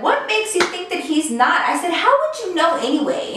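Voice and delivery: incredulous comeback. Retold or imitated. Defending boyfriend against Kyle.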